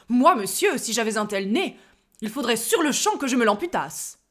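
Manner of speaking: loud, belligerent challenge; aggressive. Acted. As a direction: bark it at him like a threat. (agressif et tonitruant)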